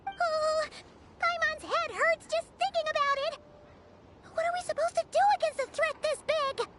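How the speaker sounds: high-pitched, worried whine; daunted fretting. Acted. Daunted companion frets about enemy.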